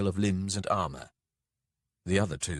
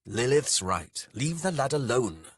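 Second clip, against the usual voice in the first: firm, raised-voice rebuke; snapped; 6 semitones higher, 5 dB louder than usual. A narrator's reading. Dake defending the lad from Beatrax, snapping. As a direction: cut in sharply to defend. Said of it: "snapped Dake".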